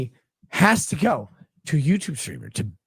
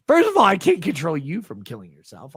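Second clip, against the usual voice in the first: ordinary talk, nothing marked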